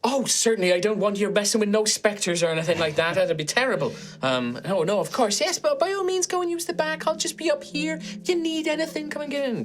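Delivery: high, fluttery, flustered voice slipping in and out of Irish; a nervous high voice that forgot its accent; flustered cafe owner. Acted. The cafe owner Maeve greets the inquisitors, high and flustered.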